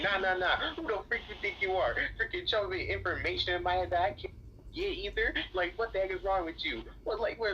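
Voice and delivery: high-pitched mock outrage; worked-up, high squawk of protest; indignant retort. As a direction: imitate someone telling you off, high and fast. Acted indignant woman scolding him.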